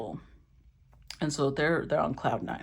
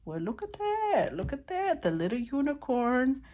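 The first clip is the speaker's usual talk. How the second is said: very high, softer coo; delighted cooing; 8 semitones higher, 3 dB quieter than usual. Real, not acted. Reader to the card just drawn, delighted cooing. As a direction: squeal softly over a lovely surprise.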